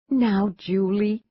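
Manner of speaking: drawn-out chiding of a daughter; mother's mild rebuke. A narrator's reading. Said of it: "the mother said".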